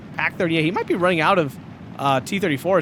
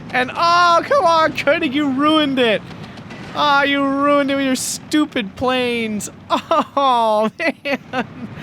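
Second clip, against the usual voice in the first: exasperated; 6 semitones higher, much slower than usual. Real, not acted. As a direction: groan at the ruined play. Caster complains at player.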